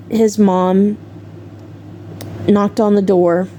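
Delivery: ordinary delivery, nothing marked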